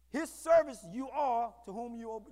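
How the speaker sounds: ordinary delivery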